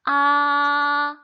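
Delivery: "아" held long on one high, level note, loud; first tone. Shown as a demonstration. Teacher demonstrating Mandarin first tone, long high level "아". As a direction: sustain "아" high and steady, no wavering. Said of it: "그 음 유지하면서 흐트러지지 말고".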